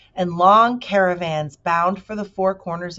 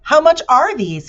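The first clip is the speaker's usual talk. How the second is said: put-on curious boy; 9 semitones higher, 11 dB louder than usual. Milo asks the salesman.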